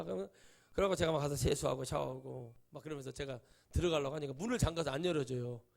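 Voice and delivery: usual speaking voice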